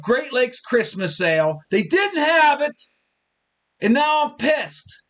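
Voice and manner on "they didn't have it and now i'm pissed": pissed. Real, not acted. Drinking host, mock fury over no Christmas ale. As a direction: rant about the missing Christmas ale. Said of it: "This is the loudest I've ever been".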